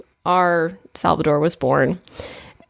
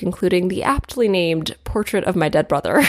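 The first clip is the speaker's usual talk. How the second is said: normal talking voice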